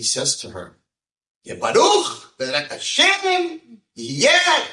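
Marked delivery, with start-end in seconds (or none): 1.4-4.6 very high, loud mock-rapturous cry; exuberant blessing. Rabbi blessing a girl with no lines, exuberant high shout. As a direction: belt it out high and drawn out.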